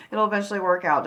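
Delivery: quick laugh through the words. Real, not acted. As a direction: laugh it off lightly. Laughs restarting the phrase.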